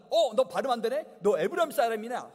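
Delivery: accusing shout. Retold or imitated. Soldier at the ford catching an Ephraimite, loud accusing challenge. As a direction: catch him out and bark the question.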